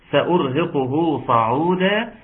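سأرهقه صعودا drawn out slowly, each letter articulated; slow Quranic recitation of سأرهقه صعودا; slow recitation demo. Shown as a demonstration. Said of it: "حد قال لي ايه".

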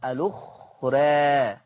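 الأخرى with the last vowel tilted toward "ee"; drawn-out fronted ending on الأخرى; taqlil. Shown as a demonstration. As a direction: say الأخرى slowly as "al-ukhrae". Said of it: "المقلل قولا واحدا".

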